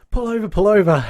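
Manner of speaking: high, laughing mock yell; mock scream. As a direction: yell it half laughing out of the sunroof. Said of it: "screaming at the Chinese laundry van dude".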